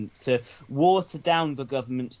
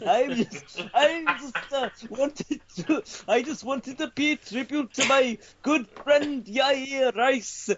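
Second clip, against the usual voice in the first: high, slow Welsh newsreader voice; bad Welsh accent, sing-song and solemn; 12 semitones higher than usual. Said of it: "it has to be a Welsh newsreader".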